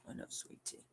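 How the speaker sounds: soft whisper to the pet; whispered coo. Real, not acted. Owner whispering fondly to her cat. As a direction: breathe it tenderly to the cat.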